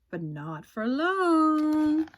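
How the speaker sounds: slow and knowing; hint at the coming twist; ominous teaser. A narrator's reading. Narrator teasing the twist, slow and sly.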